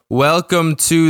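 slow, drawn-out announcer welcome; lightly spooky podcast intro; spooky show intro. Acted. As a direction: stretch out the show name like a Halloween host.

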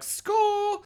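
high, drawn-out mock cheer; mock elation. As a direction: cheer like you've found free beer. Acts out ravers finding free beer.

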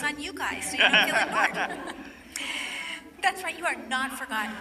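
laughing through the words; playful laughing aside. Real, not acted. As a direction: tease the crowd while laughing.

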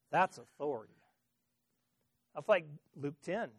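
ordinary delivery

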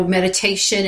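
ordinary talk

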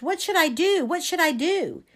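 high, fretful repeated question; panicky. A narrator's reading. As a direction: fret with rising panic. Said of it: "Panic fluttered in my chest".

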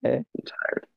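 tired whisper. Real, not acted. Friend after reading garbage text, tired whisper. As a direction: sigh it out, worn down.

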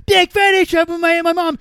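very high, loud mocking squeal; mocking parroting. Drunk man mocks a stranger's tweet back at them, squeaky and loud. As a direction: parrot their words back in a silly high voice. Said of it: "I'd go".

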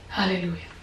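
ordinary delivery

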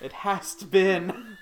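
high, slow, hammed-up recital; mock old-fashioned letter-writer. Joking about journaling on car trips, mock old-fashioned. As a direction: draw it out loudly like a period diary.

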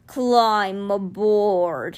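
very slow, drawn-out big-cat voice; giant cat. A narrator's reading. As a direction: stretch the words like a great calm beast. Giant cat's dialogue.

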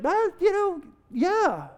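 high-pitched awkward stalling; mock noncommittal waffle; evasive hedging. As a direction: squirm upward in pitch while avoiding the truth. Hedging about friend's bad haircut.

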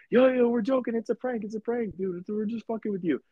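high, eager it's-a-prank reassurance; imitating excited coworkers. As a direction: play the laughing staff calling out. Staff running out laughing.

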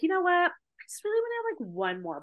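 sing-song high "you know what", talking herself into it; breezy high lilt; playful voice of her old drinking self; tempted self-talk. Retold or imitated. Retelling her past self's thought, again.

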